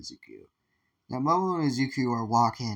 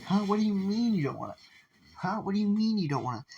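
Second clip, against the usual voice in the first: high, put-on incredulous voice, faster on the repeat; mock disbelief; 10 semitones higher than usual. Guy can't believe Zuki doesn't want to date her, high and incredulous. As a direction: act stunned in a much higher voice.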